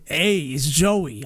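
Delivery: slow, drawn-out New York greeting; New York Joey accent. Shown as a demonstration. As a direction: lean into a Jersey-style drawl. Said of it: "going from that to".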